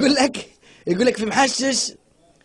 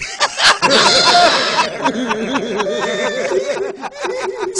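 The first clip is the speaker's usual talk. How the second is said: laughter; 7 semitones higher than usual. Real, not acted. Laughing at joke.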